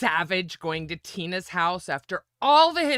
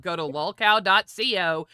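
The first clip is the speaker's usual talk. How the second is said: ordinary delivery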